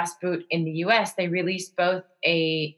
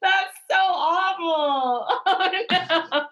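high, laughing cries of no; horrified laughing; 13 semitones higher, much slower than usual. Real, not acted. Host cringing at his stroke joke, laughing high. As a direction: squeal out the no's through laughter.

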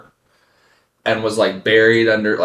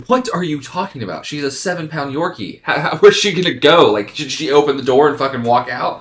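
incredulous; 7 semitones higher, 6 dB louder than usual. Retold or imitated. Retold disbelieving reply to wife.

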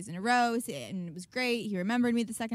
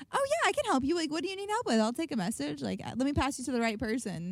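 much higher, bright customer service voice; chirpy service rep; 5 semitones higher than usual. Retold or imitated. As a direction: play the cheerful phone agent. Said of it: "they're like".

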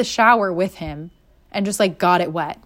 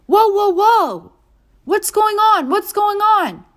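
much higher, startled man's voice; mock startled boyfriend; 13 semitones higher, 5 dB louder, slower than usual. Boyfriend stepping out of the shower, startled and high. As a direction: play the naked boyfriend caught off guard. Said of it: "And he goes".

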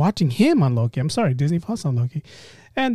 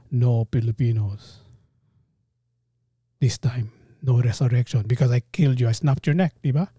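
put-on Filipino accent; 4 semitones lower than usual. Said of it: "Filipino accent".

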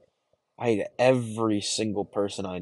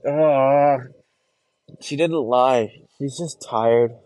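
loud self-convincing; 5 semitones higher, 8 dB louder, much slower than usual. Acted. Talking self out of hurt.

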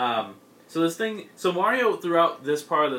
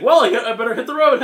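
higher, much louder and fast, a panicked getaway line; hasty Mario exit; 10 semitones higher, 12 dB louder, faster than usual. Retold or imitated. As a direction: blurt it out and bolt. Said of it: "he's like".

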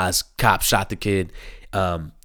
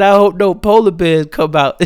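Gucci; 9 semitones higher, 7 dB louder than usual. Retold or imitated. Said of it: "He said".

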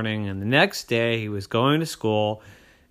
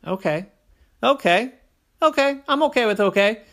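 okays rising higher and louder; growing eagerness; 12 semitones higher, 9 dB louder than usual. A narrator's reading. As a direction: build up excitement with each okay. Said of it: "said Oren".

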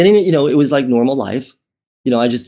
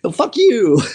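mock swagger; 7 semitones higher than usual. Mock boast about his own worth.

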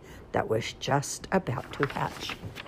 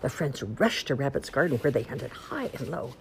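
plain reading voice

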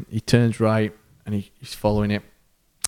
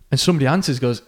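normal talking voice

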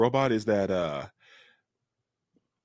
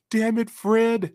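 higher, slow mock [name] grief; [name] quote; 14 semitones higher, 5 dB louder, slower than usual. Quoting the movie to friends, slow mock anguish. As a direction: drag it out like the movie line. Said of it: "you know".

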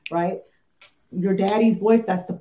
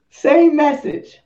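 stern dad; 8 semitones higher, 8 dB louder, slower than usual. Retold or imitated. Dad's terse words mimicked.

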